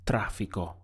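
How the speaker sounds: "tráfico" stressed on its first syllable; "tráfico" with the accented A stressed, then falling away. Shown as a demonstration. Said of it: "This is where you will stress the word".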